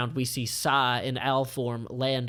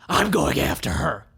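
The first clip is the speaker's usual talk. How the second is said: louder, determined villain plan; loud, driven villain; Grosvenor's voice; 7 dB louder than usual. Acted. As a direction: lay out the hunt.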